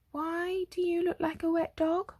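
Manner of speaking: high-pitched, lightly voiced mother; fussing mother. A narrator's reading. Mother sees her soggy son, lightly voiced high fussing.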